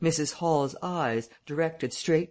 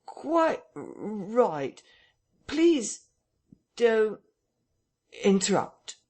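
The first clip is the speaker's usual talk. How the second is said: high vicar's voice jerking out "Quite r-, right", word by word; curious jerking intonation; 8 semitones higher, much slower than usual. A narrator's reading. Vicar answers through the door, "Quite r-, right" in strained jerks. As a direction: break "Please don't interrupt" into separate high jerks with pauses. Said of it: "answered with a curious jerking intonation".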